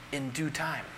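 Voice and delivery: dropped to a hushed, low answer; hushed reply to the congregation; soft answer. Acted. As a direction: let it fall soft and low. Preacher answers his own question softly.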